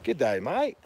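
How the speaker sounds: put-on Aussie greeting, lilting upward; amateur Australian accent with a rising finish. Shown as a demonstration. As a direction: have another go at sounding Australian. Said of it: "I can do that sort of".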